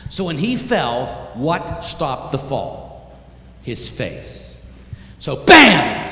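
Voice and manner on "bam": sudden loud burst on BAM; explosive, punched-out bam; impact word. Retold or imitated. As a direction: hit it like a body slamming down. Imitates the body hitting ground.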